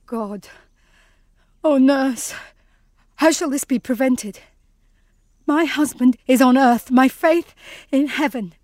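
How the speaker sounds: ordinary delivery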